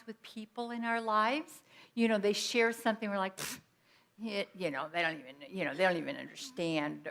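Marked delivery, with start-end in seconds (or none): 3.4-7.1 dismissive. Retold or imitated. Dismissive inner voice quoted.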